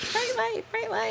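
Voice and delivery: high, soft creature squeak of bright light; tiny frightened creature voice; mock Gremlins whimper. Quoting Gremlins line.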